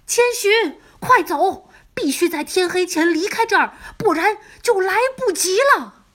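high, loud, urgent call; urgent shout. Acted. Boy warns Chihiro to flee before dark, urgent high shout. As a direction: yell a warning to hurry her away. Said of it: "他着急地大叫".

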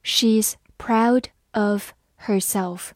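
slowly, a gap after each word; slow reading. Shown as a demonstration. Teacher giving learners a slow read-through, word by word. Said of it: "好，慢读一遍".

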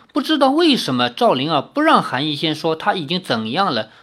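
plain reading voice

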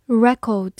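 "record" as British noun, REK-awd; British pronunciation. Shown as a demonstration. Teacher repeats the noun for learners, British accent. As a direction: say "record" the British way, stress first, no R. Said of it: "美式发音record".

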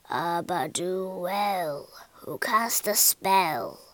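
slow, low, chanted spell; wizard's incantation. A narrator's reading. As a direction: intone it slowly like an old wizard. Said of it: "he cried".

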